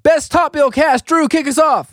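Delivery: high, slow game-show host build-up; announcer voice. Acted. Host announcing the segment to co-hosts in a big showman voice.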